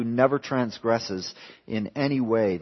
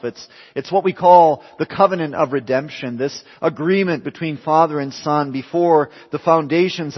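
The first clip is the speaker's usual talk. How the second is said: louder, lifted pulpit voice; fervent preaching; 4 semitones higher, 6 dB louder than usual. Acted. Preacher explaining the covenant of redemption, fervent. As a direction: preach it with conviction.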